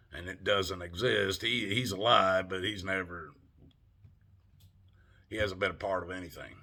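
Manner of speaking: normal talking voice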